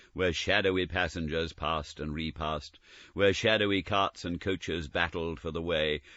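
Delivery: ordinary delivery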